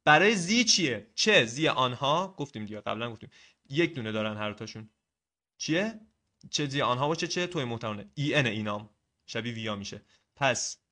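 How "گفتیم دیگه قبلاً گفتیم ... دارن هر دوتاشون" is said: ordinary talk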